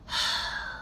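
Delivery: a soft unvoiced fed-up sigh; exasperated sigh. Shown as a demonstration. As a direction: sigh out your irritation. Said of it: "sigh when we're very irritated".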